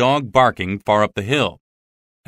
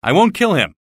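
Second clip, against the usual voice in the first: defiant; 8 semitones higher, 4 dB louder than usual. Acted.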